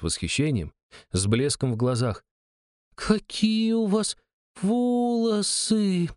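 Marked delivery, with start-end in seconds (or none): 2.9-6.1 put-on high, slow awestruck child voice; drawn-out little girl voice. A narrator's reading. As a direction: stretch every word like an amazed kid. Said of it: "протянула она".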